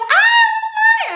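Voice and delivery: very high, loud, drawn-out squeal; mock-squeal on spotting mum; imitated child's cry. Little girl spots her mother from stage, mock high squeal. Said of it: "Aí eu".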